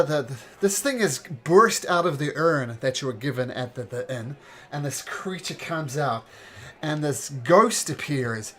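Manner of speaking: usual speaking voice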